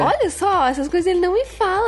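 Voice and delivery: high, fast indignant complaint; high mock outrage. Real, not acted. (indignação aguda e rápida)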